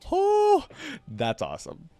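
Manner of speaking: ordinary delivery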